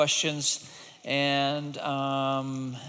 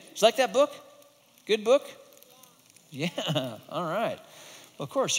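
ordinary delivery, nothing marked

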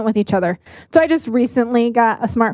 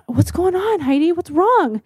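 higher-pitched, concerned questioning; retold concern; 5 semitones higher than usual. Friends noticing her tears at night, retold concern. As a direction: imitate friends suddenly noticing and asking, voice raised in pitch. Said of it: "they notice".

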